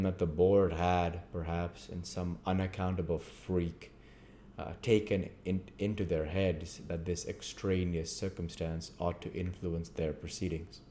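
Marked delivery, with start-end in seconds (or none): none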